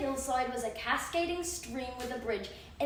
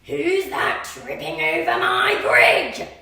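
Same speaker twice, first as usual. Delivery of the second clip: loud, slow troll bellow; troll bellowing at the big goat; 8 dB louder, slower than usual. Acted. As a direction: roar the challenge.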